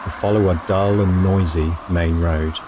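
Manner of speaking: calm, measured travel voiceover; voiceover narration. A narrator's reading. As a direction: tell it like a reflective travel diary.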